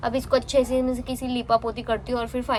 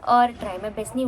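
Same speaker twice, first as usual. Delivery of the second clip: ordinary talk, nothing marked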